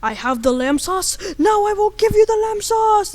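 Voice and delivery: building to a loud, high anime cry; loud high-pitched theatrical proclamation; Japanese man. Acted. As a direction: declare it like an anime hero. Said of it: "the Japanese man is like".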